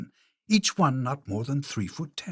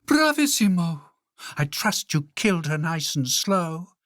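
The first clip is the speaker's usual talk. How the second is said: much higher and louder, cruel triumph; gleeful cry; 6 semitones higher, 4 dB louder than usual. Acted. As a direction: relish the news with wicked delight. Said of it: "The Queen cried out".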